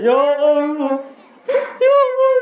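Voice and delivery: very high drawn-out wail, hammed up; mock sobbing. Pilgrim praying alone before the Kaaba, mock wailing. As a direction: imitate a man bawling his prayer. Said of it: "nangislah dia senangis-nangisnya".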